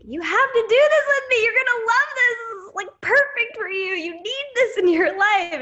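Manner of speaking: hammed-up eager salesgirl begging her friend; gushing pitch. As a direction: squeal it like the best thing ever.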